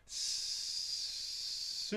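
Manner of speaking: "Sur" with a long whispered S; demonstrating a voiceless S; unvoiced S. Shown as a demonstration. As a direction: hiss the S before the U. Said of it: "Tu ne peux pas chanter un U".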